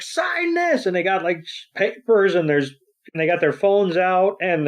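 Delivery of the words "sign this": imitated child fan; eager fan kid. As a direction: beg for an autograph like a tiny fan. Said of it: "they're like".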